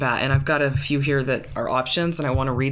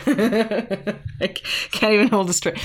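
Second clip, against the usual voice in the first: breaking up with laughter mid-sentence; laughing; 5 semitones higher than usual. Real, not acted. Cracking up over her own joke while talking to her husband. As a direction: laugh through the words, unable to stop. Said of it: "ruined my own joke by laughing".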